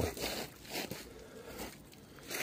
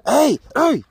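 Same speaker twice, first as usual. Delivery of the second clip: loud, high call to stop the dog; 11 dB louder than usual. Real, not acted. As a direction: call him off sharply from a distance. Calling the dog back.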